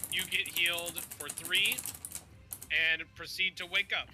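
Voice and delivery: ordinary talk, nothing marked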